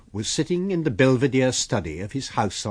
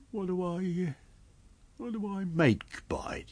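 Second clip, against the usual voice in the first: high, softer tramp voice; wheedling tramp; 9 semitones higher, 5 dB quieter than usual. Acted. Tramp trying another tack with his master, high wheedling. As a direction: try bargaining in a high, hesitant voice.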